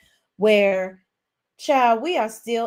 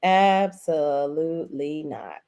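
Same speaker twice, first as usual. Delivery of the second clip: slow, drawn out syllable by syllable; emphatic refusal; 4 semitones lower, slower than usual. Real, not acted. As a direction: stretch every syllable of "Absolutely not", low and final. Emphatic slow repeat of her verdict.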